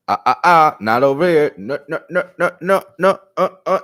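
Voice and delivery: loud, high "ah ah ah, not over here"; a stern parent wagging a finger; scolding God. Retold or imitated. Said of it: "God is like".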